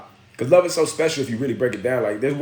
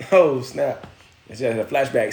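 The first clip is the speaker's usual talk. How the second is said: usual speaking voice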